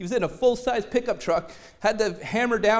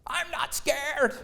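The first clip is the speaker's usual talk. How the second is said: mock denial; 6 semitones higher, 3 dB quieter, much slower than usual. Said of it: "we're like this".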